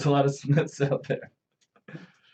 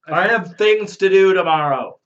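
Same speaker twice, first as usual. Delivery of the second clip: protesting; 6 semitones higher, 8 dB louder than usual. Real, not acted. Protesting the drink.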